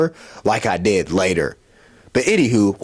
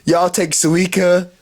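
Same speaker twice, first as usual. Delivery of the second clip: higher, louder, slow Hey, y'all take Suica; drunk calling out; 9 semitones higher, 3 dB louder, much slower than usual. Retold or imitated. Drunk at the izakaya door asking about Suica, loud and high. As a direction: call out cheerfully at the door. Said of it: "I'm like".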